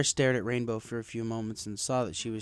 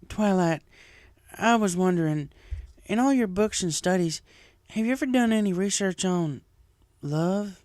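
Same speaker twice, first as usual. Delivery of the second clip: higher, louder country voice; Applejack, hesitant farm pony; 8 semitones higher, 5 dB louder than usual. Acted. Applejack asking Twilight about love research, hesitant raised voice. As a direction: ask shyly but loud.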